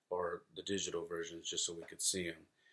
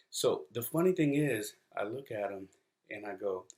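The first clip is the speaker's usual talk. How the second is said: ordinary talk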